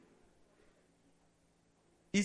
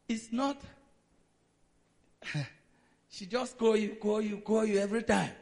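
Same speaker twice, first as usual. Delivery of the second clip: ordinary talk, nothing marked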